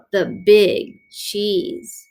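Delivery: slow grand rising name; drawn-out name. A narrator's reading. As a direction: announce the Big Cheese with relish.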